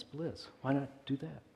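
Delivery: ordinary talk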